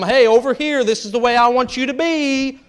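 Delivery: hammed-up bossy shout; mock calling out. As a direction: herd them like cattle. Mock corralling a spouse.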